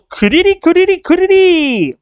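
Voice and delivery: very high, rising repeated cry; high trilling call. Retold or imitated. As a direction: trill it out loud, stretching the last. Imitating a cry from the comic.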